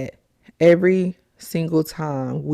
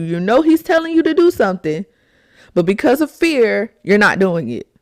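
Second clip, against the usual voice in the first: normal talking voice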